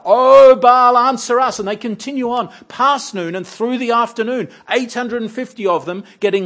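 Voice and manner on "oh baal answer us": high, very loud desperate plea; a pagan prophet's shouted plea; frantic cry to Baal. Pretend. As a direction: cry out to a god who won't answer. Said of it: "taunts them to cry out louder".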